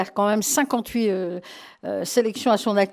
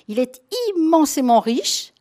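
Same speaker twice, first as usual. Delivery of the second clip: ordinary talk, nothing marked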